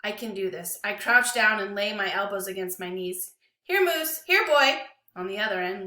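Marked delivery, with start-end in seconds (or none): 3.6-4.8 high, loud coaxing call to a dog. Acted. Boy coaxing his dog across the dirt, calling out loud. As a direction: call the dog across the yard, raised and bright.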